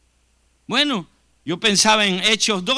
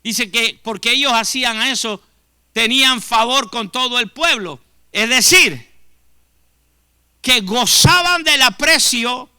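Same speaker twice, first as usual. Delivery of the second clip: louder, building emphasis; rising preaching; 4 semitones higher, 10 dB louder than usual. Acted. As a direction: lift it toward the climax. Preaching on Acts church.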